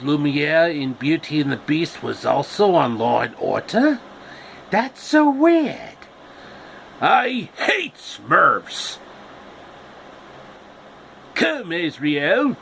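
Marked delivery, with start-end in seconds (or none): 4.7-6.0 much higher, slow squeaky character voice; high silly cartoon voice. 7.0-12.6 higher, very slow villain snarl; gloating villain calling his cat. Acted. Villain voiced in story.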